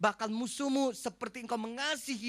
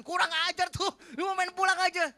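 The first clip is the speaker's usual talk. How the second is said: higher, louder indignant outburst; outraged wife; 10 semitones higher, 8 dB louder than usual. Retold or imitated. Wife outraged the nurse quits after ten fillings: higher, louder indignant outburst. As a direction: blow up at him as the furious wife.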